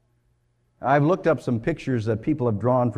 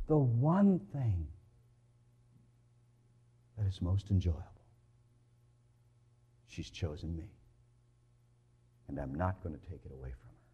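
soft Jesus voice; 7 semitones lower, 11 dB quieter than usual. Acted. Voicing Jesus softly.

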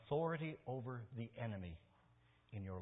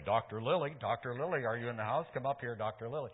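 mock altar call; 8 dB louder than usual. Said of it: "I don't start to pray for people and say".

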